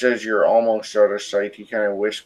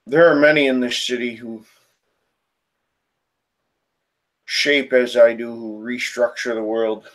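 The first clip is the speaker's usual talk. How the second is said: deep throaty spirit. Acted. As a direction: announce yourself like an ancient, animalistic spirit. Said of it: "in this deep, throaty voice".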